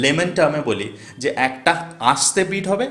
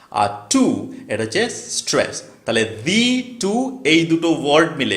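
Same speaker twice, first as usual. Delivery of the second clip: normal talking voice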